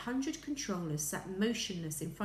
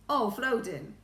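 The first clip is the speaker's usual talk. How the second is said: corrected character voice; 4 semitones higher, 7 dB louder than usual. A narrator's reading. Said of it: "got the wrong voice".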